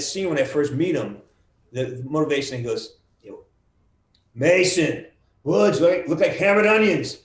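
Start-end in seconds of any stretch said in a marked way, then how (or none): 4.3-7.2 higher, louder gruff soldier voice; in-character line. Acted. Actor replays his character's line to the host, loud and higher. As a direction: bark it like a jungle grunt. Voice actor quoting game scene.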